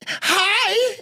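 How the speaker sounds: squeaky, bubbly hello way up high; high excited hi. Retold or imitated. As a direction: chirp it like an overexcited girlfriend. Girlfriend answering phone excitedly.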